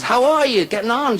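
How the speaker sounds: high, hearty Irish husband; Murphy. Acted. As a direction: call down the phone as Murphy. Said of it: "He says".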